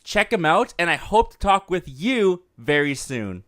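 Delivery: upbeat scripted voice-over; announcer read. Acted. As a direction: read it like a show intro announcement. Reads the recorded show intro.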